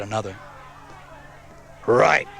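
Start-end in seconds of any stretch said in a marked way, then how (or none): 1.9-2.3 one loud snarled word. A narrator's reading. Biker boss Wolf agreeing with his gang, snarling. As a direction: snarl it forcefully. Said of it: "snarled Wolf".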